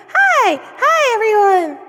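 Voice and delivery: high, loud, bursting hello; excited greeting. Real, not acted. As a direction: cheer them in with a big shout.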